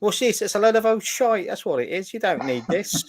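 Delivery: high, hammy Cockney market trader; bad English accent. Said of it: "appalling attempt at an English accent".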